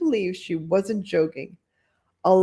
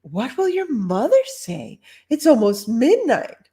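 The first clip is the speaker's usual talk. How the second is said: higher fussing voice; concerned lady; 7 semitones higher, 3 dB louder than usual. Acted. Lady fussing at the girl out late, higher voice. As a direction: fuss over the child. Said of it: "asked another lady".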